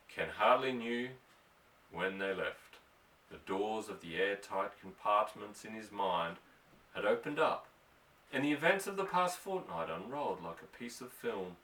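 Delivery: ordinary delivery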